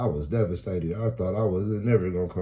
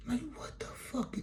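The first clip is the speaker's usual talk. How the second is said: soft, voiceless exclamation of bafflement; acted stunned whisper; whispered disbelief; 9 dB quieter than usual. Baffled at being shot at.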